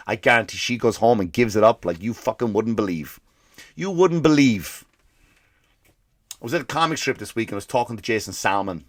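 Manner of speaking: normal talking voice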